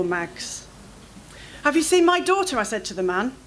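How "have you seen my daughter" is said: high, urgent, pleading question; a frantic mother begging a stranger; frantic mother begs a stranger for help; desperate plea. Acted. Asks man about lost daughter.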